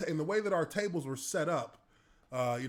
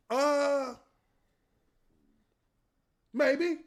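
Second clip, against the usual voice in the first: much higher, hesitant admission; sheepish maybe; 10 semitones higher, 3 dB louder than usual. Retold or imitated. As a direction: shrug it off meekly. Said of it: "I'm just like".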